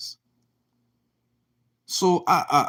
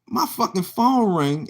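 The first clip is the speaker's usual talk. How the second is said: ordinary talk, nothing marked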